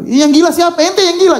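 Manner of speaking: high, heated comeback in playful outrage; acted angry retort; mock indignant retort. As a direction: throw it back as if insulted, pitch jumping up. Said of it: "Nabi tidak marah-marah ... kalau saya mungkin begitu".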